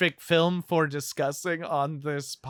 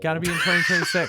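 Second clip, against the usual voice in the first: insistent repeat; much faster than usual. Pretend. Host overruling co-host's no about the year, mock insistent. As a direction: push it out fast over the objection.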